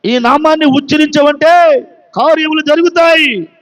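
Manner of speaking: a rousing preacher's cry; preaching shout. Acted. Preacher proclaims the power of the name, shouting. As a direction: shout it out to the church.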